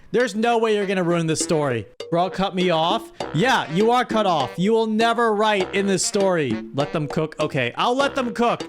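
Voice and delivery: usual speaking voice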